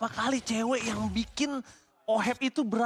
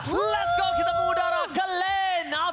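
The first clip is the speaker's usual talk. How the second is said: crowd-hyping shout, slow and high; hype shout; 10 semitones higher, 4 dB louder, slower than usual. Real, not acted. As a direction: fire up the crowd. Hypes crowd at watch party.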